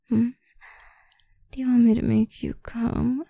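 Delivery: ordinary delivery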